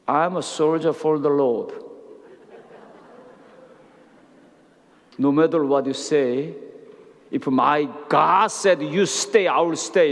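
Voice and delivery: very slow, deliberate, every word weighted; firm declaration. Retold or imitated. Pastor firmly declares his faith to the doubting consul, very slow. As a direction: plant each word like a vow.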